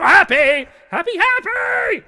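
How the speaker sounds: HAPPY screamed very high and loud; insistent shouting. Acted. Phantom chorus overwhelms Steve, "Happy" in full shout. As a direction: shriek "Happy" over and over, drawing out the last. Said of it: "They go".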